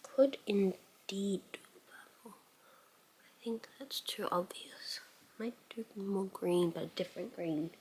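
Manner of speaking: hushed, voiceless musing over "Could indeed do a purple"; whispering to herself. Real, not acted. Beauty vlogger debating a purple eyeshadow, whispering to herself. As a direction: breathe the words as a private thought.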